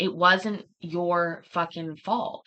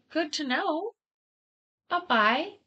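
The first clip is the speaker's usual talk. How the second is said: sarcastic goodbye; 9 semitones higher than usual. Retold or imitated. Sarcastic farewell to bank.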